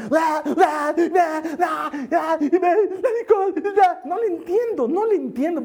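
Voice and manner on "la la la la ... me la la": put-on high, loud blubbering like a child crying; mock crying babble. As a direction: cry out la la la like a sobbing little girl. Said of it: "llora como la chilindrina".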